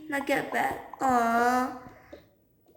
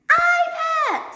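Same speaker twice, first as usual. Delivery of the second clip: loud, high, stretched scream; distraught shriek; 7 dB louder than usual. Acted. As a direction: shriek over a lost treasure. Child screams about lost iPad.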